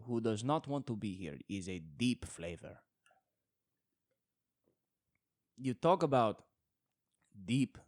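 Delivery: put-on over-the-top Italian nonna story in an Italian accent. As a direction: ham up a mournful Italian accent. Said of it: "mixing into a Spanish accent".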